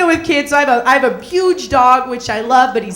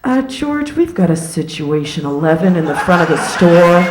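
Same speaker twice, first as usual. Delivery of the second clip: mock store clerk; 6 semitones lower than usual. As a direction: play the fussy clerk calling for a ladder. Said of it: "he goes".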